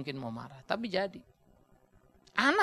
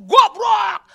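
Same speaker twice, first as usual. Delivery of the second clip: a furious parent's loud, high yell; angry shout; 13 semitones higher, 16 dB louder than usual. Acted. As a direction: bark the insult at the child in rage. Angry parent yelling at child. (bentakan marah orang tua)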